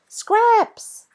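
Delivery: loud, high joyful yell. A narrator's reading. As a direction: call out to the dog with all your breath. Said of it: "Jack yells".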